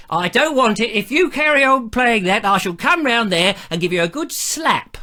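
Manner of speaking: mock threatening listener, lightly put on; Pearl. Listener threatening host over the music, mock scolding. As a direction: pitch up into a hammed-up telling-off. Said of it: "everybody I do an impersonation all speaks like that".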